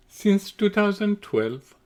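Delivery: normal talking voice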